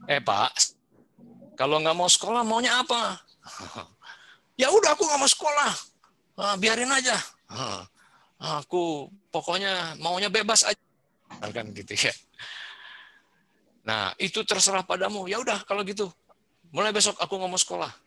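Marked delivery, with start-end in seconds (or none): 0.0-3.2 rising, higher mock-puzzled father; questioning parent. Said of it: "Contoh ya, Bu". 4.6-10.8 put-on defiant child. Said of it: "child answering". 13.9-17.9 put-on high, fast careless kid; defiant child. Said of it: "child continues".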